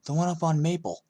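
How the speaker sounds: high, loud, excited gossip; eager kid Evan. A narrator's reading. Classmate Evan pestering the new kid, high and loud. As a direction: blurt it out like a nosy classmate.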